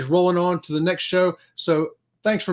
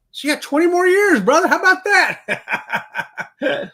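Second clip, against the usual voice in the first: much higher, laughing through the words; laughing tease; 13 semitones higher, 6 dB louder, slower than usual. Real, not acted. Teasing James that he has twenty years left, laughing. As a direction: rib the viewer, grinning, breaking into laughter. Teasing viewer about age.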